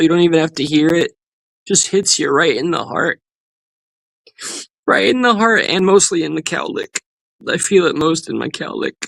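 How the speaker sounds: high-pitched, hammed-up choking up; mock emotional. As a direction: pretend to be deeply moved, pitch climbing. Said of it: "I get emotional".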